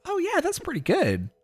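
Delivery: high, soft mock self-congratulation; quietly pleased with yourself, high; pleased self-approval. Retelling approving own impression, high and soft.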